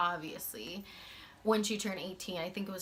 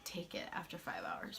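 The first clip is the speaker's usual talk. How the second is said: whisper; 9 dB quieter than usual. Real, not acted. Repeated quietly.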